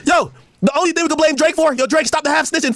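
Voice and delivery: ordinary talk, nothing marked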